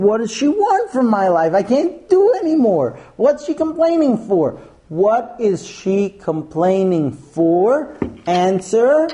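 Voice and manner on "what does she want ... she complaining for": high, exasperated complaint, rising higher; exasperated husband. Retold or imitated. Poor Mike scratching his head, fed up and pitched high. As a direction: throw up your hands in disbelief.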